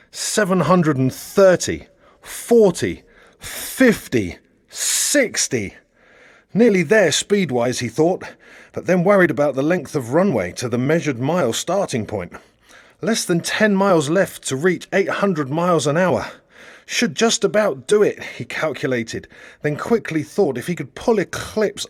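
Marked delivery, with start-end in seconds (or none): 0.1-5.8 building higher and louder as speed climbs; rising excitement. A narrator's reading. Narrator counting the speedometer up, rising excitement. 6.5-7.8 higher and louder, keyed up; almost there, lift it; eager anticipation. A narrator's reading. Narrator voicing Johnny nearly at speed, keyed up. 16.9-18.2 high, tense estimate; hopeful but nervous. A narrator's reading. Johnny reckoning the run aloud, tense hope.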